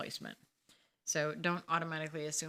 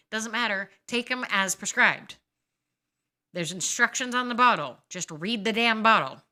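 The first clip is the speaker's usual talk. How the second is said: furious shouting, louder and higher with each line; angry rant; 5 semitones higher, 13 dB louder than usual. Real, not acted. Scolding patients who stop antibiotics early, furious shouting. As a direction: blow up at them, building to a yell.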